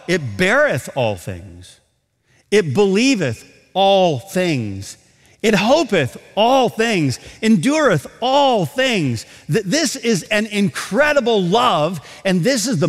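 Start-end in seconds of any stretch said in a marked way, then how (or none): 0.1-9.2 put-on slow, deliberate reading of old Bible words, weight on each line; solemn scripture reading. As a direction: read it out like a preacher in the pulpit. Reads King James Scripture slowly.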